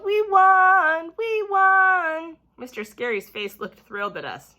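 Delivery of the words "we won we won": much higher, louder cheering; yelled. A narrator's reading. Class cheering their win, high loud yell. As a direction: shout it in pure joy. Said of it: "danced and yelled".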